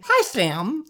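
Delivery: put-on high little girl with a lisp. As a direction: lisp it like a small child. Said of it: "she had the lisp".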